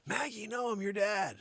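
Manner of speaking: high panicked plea. Retold or imitated. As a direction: beg the dog not to eat you. Owner pleading as dog bites.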